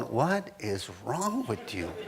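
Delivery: wife baffled by her panting husband, puzzled; mock puzzled wife. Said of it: "you're looking at him going".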